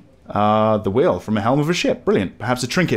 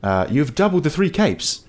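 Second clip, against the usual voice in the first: ordinary talk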